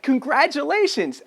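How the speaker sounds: high, slow beaming congratulations. Retold or imitated. Salesman at her door with flowers, beaming. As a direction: greet her like a prize winner.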